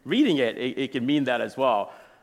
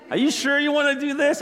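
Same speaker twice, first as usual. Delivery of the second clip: high-pitched, doubtful question; raised pitch, playfully skeptical; mock incredulous wife; 13 semitones higher, 4 dB louder than usual. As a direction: imitate a wife questioning a rash plan. Wife doubts his potty plan.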